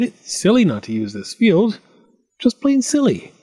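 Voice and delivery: high-pitched exasperated farmer; indignant solo grumble; grumbling to himself. A narrator's reading. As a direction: complain to yourself, pitch climbing. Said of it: "talking to himself".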